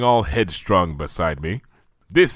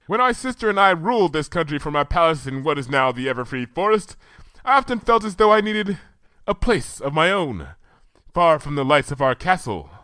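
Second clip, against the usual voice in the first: slightly higher, wistful royal recollection; remembering an ancient reign; lightly voiced Luna; 8 semitones higher than usual. A narrator's reading. Luna telling Twilight of her past, lightly regal.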